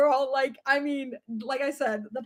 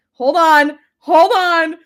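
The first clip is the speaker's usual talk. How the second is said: louder; excited; 3 semitones higher, 11 dB louder, much slower than usual. Real, not acted. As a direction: bounce with excitement. Excited countdown setup.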